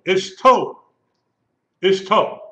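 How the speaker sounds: "Ishto" with the TO punched high and loud; stress on last syllable. Shown as a demonstration. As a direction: hit the final syllable of "Ishto", ish-TO. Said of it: "The accent is on the last syllable".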